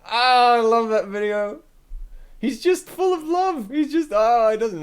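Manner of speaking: very high, melting coo; gushing adoration. Real, not acted. Streamer loving a dog video, gushing. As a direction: gush in a high voice over something adorable.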